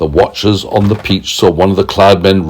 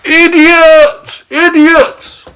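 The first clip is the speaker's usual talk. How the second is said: yelling; much slower than usual. A narrator's reading. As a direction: yell insults across the sky. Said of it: "he yelled".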